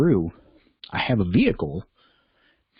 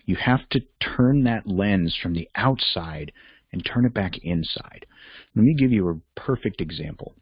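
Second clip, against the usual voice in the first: normal talking voice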